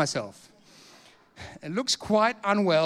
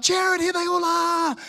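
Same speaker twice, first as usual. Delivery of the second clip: high, drawn-out announcement; very excited; 11 semitones higher, slower than usual. Retold or imitated. Convert showing his family to the preacher, excited. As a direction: imitate an excited man presenting his whole family. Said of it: "he's so excited".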